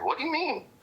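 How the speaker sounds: high, baffled what do you mean; playing the confused buddy who knows nothing; a retold startled question pitched high; puzzled question. Friend's confused reply, retold.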